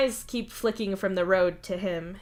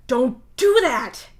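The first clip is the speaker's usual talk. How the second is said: loud, raised, drawn-out scolding; snapping; 6 semitones higher, 9 dB louder, slower than usual. Acted. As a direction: snap it loudly, leaning on the words. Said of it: "you snap at him".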